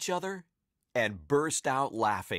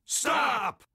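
high, sudden police shout; shouted command; 12 semitones higher, 4 dB louder than usual. A narrator's reading. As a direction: yell it after someone escaping. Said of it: "cried the cops".

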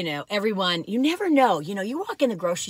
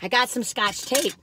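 normal talking voice

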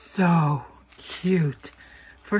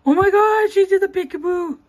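sharp gasp then a much higher, louder cry; gasping surprise; 13 semitones higher, 8 dB louder than usual. Real, not acted. Finding the peekaboo cutout, real surprised cry.

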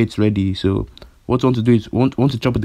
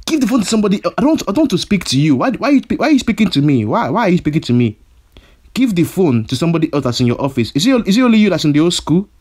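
furious rant; 6 semitones higher than usual. Acted. Furious ranting at caller.

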